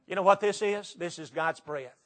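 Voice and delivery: ordinary talk, nothing marked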